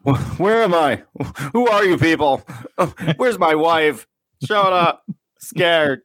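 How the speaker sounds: high mock-frantic bewilderment; high panicked confusion. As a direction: play a dazed man squeaking in panic. Acting confused waking stranger.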